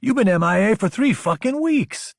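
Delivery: high, indignant complaint; exasperated outburst. A narrator's reading. Friend berates Logan for his absence.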